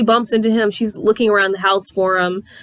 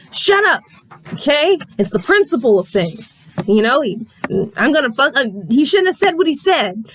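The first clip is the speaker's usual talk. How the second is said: defensive mother; 6 semitones higher than usual. Retold or imitated. Mother snaps back defensively.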